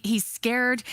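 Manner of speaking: ordinary talk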